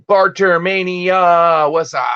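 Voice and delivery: loud, high, stretched hail; drawn-out shouted greeting. Real, not acted. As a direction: call out the name long and high to welcome them. Streamer hails a viewer arriving.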